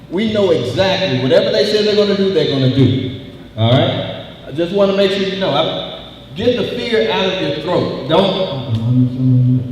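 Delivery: loud, driving rally speech to the crowd. Acted. Union organizer rousing workers at the plant, full-force rally voice.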